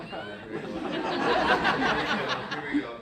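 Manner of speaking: high laughing here we go; laughing groan. Retold or imitated. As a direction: laugh through the dread. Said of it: "and I'm like".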